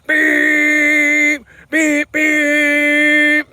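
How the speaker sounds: high, loud mock honking; shouted horn beeps. Cyclist imitating the tailgater's horn a second time, shouted high beeps. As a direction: blast it like a car horn.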